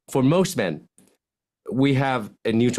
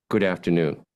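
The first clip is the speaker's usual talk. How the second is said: lower, sad good afternoon; 6 semitones lower than usual. Shown as a demonstration. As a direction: let it drop, with a sad face. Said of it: "now make a sad face".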